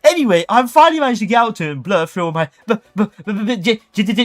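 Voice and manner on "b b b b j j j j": stammering. Retold or imitated. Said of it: "stammering from fear".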